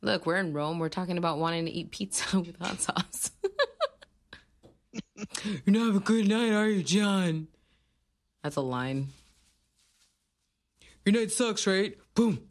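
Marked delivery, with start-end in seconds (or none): 5.4-12.4 teasing taunt, louder and higher; mock taunting. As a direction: jeer at him like a bully. Voicing lines at the screen.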